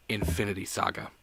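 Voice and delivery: dramatic whispered title. Pretend.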